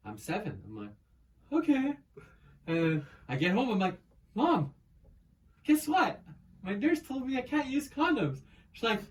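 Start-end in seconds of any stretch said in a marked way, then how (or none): 1.5-2.0 put-on high, innocent little-kid okay; much higher naive child voice; childish okay. Child self in retold story. 4.3-8.4 put-on high, louder, eager little kid; much higher kid voice telling mom; excited child.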